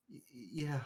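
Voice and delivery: very quiet, broken stutter; tiny faltering in-character reply; speechless stammer. Acted. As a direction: barely get the word out, stuttering. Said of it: "She can't even speak words".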